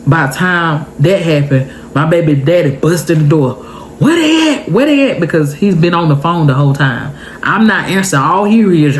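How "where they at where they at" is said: high-pitched urgent questioning; retold alarm, pitched high; imitated frantic arrival. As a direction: burst in demanding to know, voice pitched way up. Baby daddy bursts in, retold.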